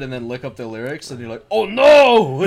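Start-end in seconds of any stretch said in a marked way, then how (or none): 1.5-2.4 high, loud, laughing "oh no"; mock dismay. Finding the lyrics are awful, loud laughing mock dismay. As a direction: cry out in comic horror.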